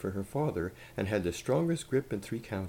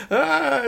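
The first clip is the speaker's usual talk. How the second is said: put-on high, very loud old lady's scream; terrified scream; 12 dB louder than usual. A narrator's reading. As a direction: shriek in sudden fright. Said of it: "screamed Grandma".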